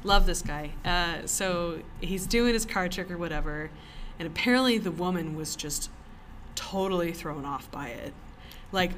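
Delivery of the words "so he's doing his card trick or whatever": ordinary talk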